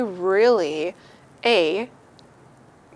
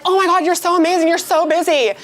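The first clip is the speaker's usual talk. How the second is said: gushing admirer; 8 semitones higher, 7 dB louder, faster than usual. Retold or imitated. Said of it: "people would be like".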